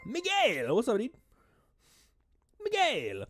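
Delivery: excited greeting. Real, not acted. Streamer greeting a viewer in chat, excited call. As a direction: sing out the name to welcome him.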